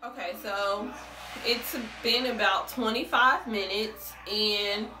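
through a dried face mask; stiff face mask on. Real, not acted. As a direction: lips barely moving.

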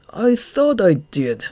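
high, slow, loud faltering excuse. A narrator's reading. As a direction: hesitate guiltily before the boss. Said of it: "Throb faltered".

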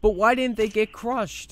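loud, high disbelieving yell; frustrated shout. Real, not acted. Gamer yelling at the game as enemies survive, loud frustrated shout.

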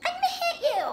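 very high, very fast squeaky threat. Acted. A squeaky character threatening her rival, very high and fast. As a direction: rattle off a shrill cartoon threat.

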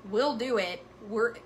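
ordinary delivery

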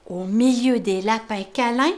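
ordinary delivery

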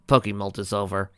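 ordinary delivery

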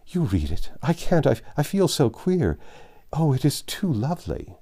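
overwhelmed joy. Acted. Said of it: "quite upset by her present".

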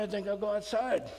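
ordinary talk

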